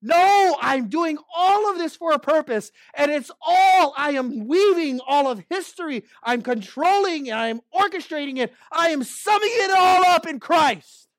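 high, loud, commanding voice of God; booming divine declaration; voicing God. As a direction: proclaim it as God speaking with full authority. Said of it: "And yet God says".

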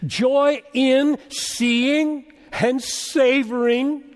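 loud, high proclamation with stretched key words; ringing, emphatic sermon fervor; impassioned preaching. Real, not acted. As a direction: proclaim joy from the pulpit, slowing for weight. Preaches at full force.

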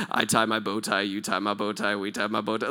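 low, quiet singsong of the tongue twister; mock tongues phrase. Shown as a demonstration. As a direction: rattle it off like tongues. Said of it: "you just say that really quick".

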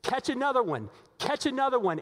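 egging on the boat, rising excitement; guy running around the boat cheering on catches, excitedly; excited fisherman. Retold or imitated. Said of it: "another guy running around ... going".

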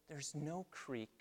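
soft and slow, almost breathed; hushed demo. Shown as a demonstration. Pastor describing the fixed door in a soft, slow hush. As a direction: let it fall quiet like the silent door. Showing the door is now silent.

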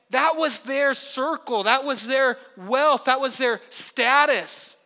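each "That was their" said slow and weighty; emphatic slow build. Acted. Pastor pressing the congregation on what was lost, slow and emphatic. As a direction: lean on every line, slowly, for effect. Preacher driving home a point.

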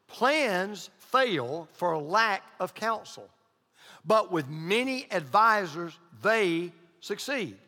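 slow, deliberate scripture read. Shown as a demonstration. Pastor reading Proverbs to the congregation, slow and solemn. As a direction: read the verse slowly and weightily.

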